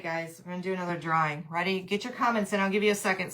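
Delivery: usual speaking voice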